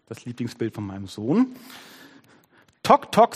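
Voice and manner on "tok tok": high, punchy woodpecker knocks; loud, staccato tok-tok; knocking noise. A narrator's reading. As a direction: rap out each knock sharply. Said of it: "so klang es".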